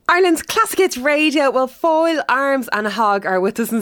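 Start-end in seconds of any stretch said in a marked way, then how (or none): 0.1-1.5 jingle announcer. Acted. Host repeats the station name as jingle.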